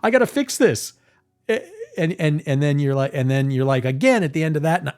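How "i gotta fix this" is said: fast, much higher frantic fixing; mock panic rushing to repair; hammed-up panic; full blown panic. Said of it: "full blown panic".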